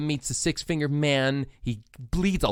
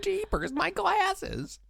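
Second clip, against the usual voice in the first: far higher, cartoon-girl squeak; Velma impression; 3 dB quieter, much slower than usual. Joking about the Scooby-Doo movie, high cartoon Velma squeak. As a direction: fret like a cartoon sleuth.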